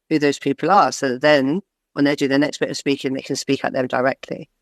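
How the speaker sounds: normal talking voice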